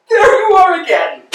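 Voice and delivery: high, ringing exclamation; elated cry. Acted. Vladimir greets Estragon's return, crying out gleefully. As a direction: call it out with glee.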